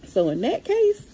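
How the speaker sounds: high and loud, building up to a decision; resolving to return the table to the store; emphatic. Real, not acted. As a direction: rise up as if announcing a verdict.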